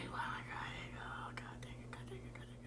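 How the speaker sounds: very soft, unvoiced-sounding whispered dismay; tearful whisper. Real, not acted. Viewer moved by the show, about to cry, tearful whisper. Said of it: "And we're gonna cry".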